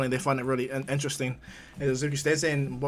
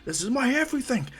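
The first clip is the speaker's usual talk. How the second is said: high, strained-up fighter's speech; impassioned, high-pitched declaration; battle declaration; 11 semitones higher than usual. Acted. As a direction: declare your final move mid-fight. Fighter declares his all-out move.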